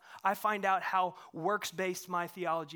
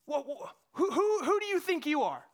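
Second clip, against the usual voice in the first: loud, higher, indignant challenge; bitter outburst at God; 7 semitones higher, 6 dB louder than usual. Retold or imitated. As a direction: demand it of God, affronted. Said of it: "and I'll go".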